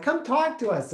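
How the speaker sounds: high, eager come talk to us; imitating her sweet parents; sweet parents' invitation. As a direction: coax him in like a doting parent.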